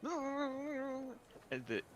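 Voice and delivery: tiny fairy tee-hee; squeaky sprite laugh; high-pitched giggle. Acted. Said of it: "followed by a high-pitched".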